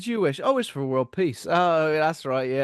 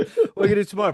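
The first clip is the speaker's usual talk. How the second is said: raised, a bit louder, steady sly ask; smug sly question; 7 semitones higher, 6 dB louder, much faster than usual. Acted. Genie baiting the bouncer with a sly question. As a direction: ask it like a con man setting a trap. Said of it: "calmer retake of the same line".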